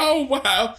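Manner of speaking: high, laughing, breaking voice; laughing disbelief. Retold or imitated. Telling her friend about the slip, laughing disbelief. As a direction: squeal it through laughter. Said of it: "I was like".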